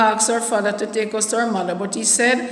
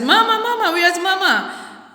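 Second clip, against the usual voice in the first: retold searching cry, pitched way up; child calling for mother; 9 semitones higher than usual. Reaching home and running in to find her mother, high child's call.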